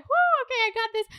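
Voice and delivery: high, hyped-up self pep talk after a shot; retelling the shot she took to hype herself up before the date, a high excited whoop; psyched-up whoop. Retold or imitated. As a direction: whoop yourself into courage. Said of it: "I took a shot and I was like".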